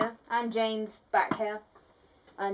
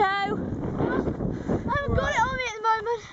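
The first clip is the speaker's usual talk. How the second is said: put-on high whiny girl voice; 13 semitones higher, 4 dB louder than usual. High voice as Jane.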